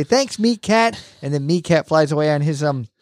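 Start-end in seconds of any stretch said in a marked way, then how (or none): 0.0-1.0 put-on higher kid-like thanks; lightly voiced kids; kids' thanks. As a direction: cheer like a kid. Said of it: "all the kids say".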